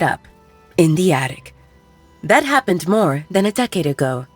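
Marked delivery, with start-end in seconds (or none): none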